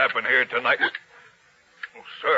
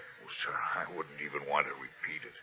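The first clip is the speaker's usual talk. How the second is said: reluctant hush; 6 semitones lower, 11 dB quieter than usual. Acted. Sentry reluctant to repeat tale.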